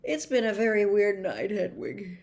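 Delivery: sleepy words through a yawn; tired boy yawning to his owl. A narrator's reading. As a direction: say it mid-yawn, drowsy. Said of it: "he yawned".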